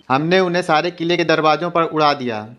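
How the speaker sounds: ordinary delivery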